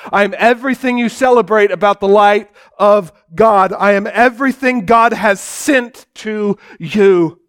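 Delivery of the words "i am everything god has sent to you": usual speaking voice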